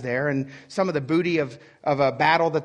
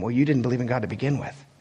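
usual speaking voice

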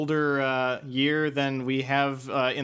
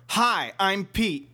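slower, more deliberate hello; slower redo; 6 semitones higher, much slower than usual. Shown as a demonstration. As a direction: say it again, carefully. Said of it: "Start over".

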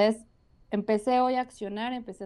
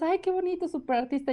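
mock gushing; 8 semitones higher than usual. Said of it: "Y todos".

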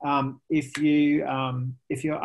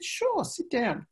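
Picasso agreeing; 10 semitones higher, 4 dB quieter, slower than usual. Retold or imitated. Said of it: "in French. I don't do a French accent".

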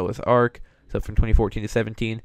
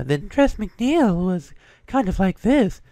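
higher, slow, airy cartoon voice; Tress MacNeille; 9 semitones higher, slower than usual. Shown as a demonstration. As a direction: imitate an airy, high-pitched character voice. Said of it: "a little bit more airy".

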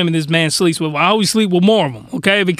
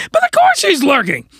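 high, strained exclamation; exasperated high-pitched outburst; mock screaming; 11 semitones higher than usual. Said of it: "do a little screaming there".

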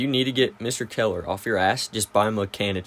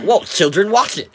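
higher, louder startled warning; mock startled teacher; 9 semitones higher, 6 dB louder than usual. Teacher startled by a welding rod tapping him, mock alarm. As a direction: jump and scold like the teacher.

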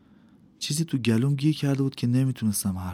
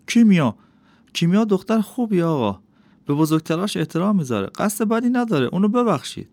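high, louder anxious pleading; worried; 8 semitones higher, 4 dB louder than usual. A narrator's reading. Father anxiously pleading with the master for his daughter, worried. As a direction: beg forgiveness for her, voice climbing. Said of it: "پدرم با نگرانی گفت".

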